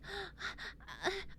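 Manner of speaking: soft, breathy wounded gasp; weak gasp. Retold or imitated. Patrick gasps as rescuers arrive, soft and wounded. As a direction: catch your breath like you're hurt. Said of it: "he's like".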